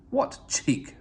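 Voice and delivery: indignant splutter, breathy and clipped; a mock-huffy engine sputtering. A narrator's reading. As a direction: splutter it out, offended. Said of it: "spluttered Gordon".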